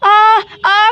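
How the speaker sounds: loud strained groans of pain. Acted. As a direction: groan hard through labor. Ketaki in labor pain.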